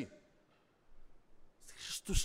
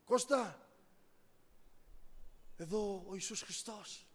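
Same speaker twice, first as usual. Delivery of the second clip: voice of Christ; 6 dB quieter than usual. Retold or imitated. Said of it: "Και άκουσε φωνή".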